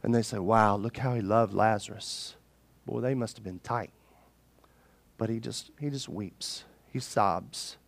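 soft, low, subdued telling; hushed solemnity. A narrator's reading. Preacher telling how Jesus wept for Lazarus, hushed and low. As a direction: let the voice drop away, quiet and low.

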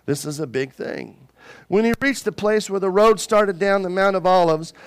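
ordinary talk

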